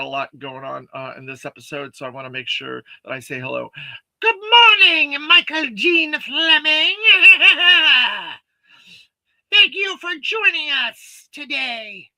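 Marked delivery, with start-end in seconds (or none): 4.2-12.1 high, slow sneering villain greeting. Acted. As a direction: greet them like a cartoon tyrant.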